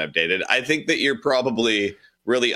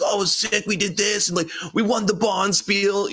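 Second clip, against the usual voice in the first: high-pitched imitation of a hyped friend; all hyped up; 11 semitones higher than usual. Retelling a coworker's curling win, all hyped. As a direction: gush about the win, imitating her. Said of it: "she'd get all hyped".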